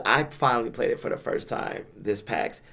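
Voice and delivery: normal talking voice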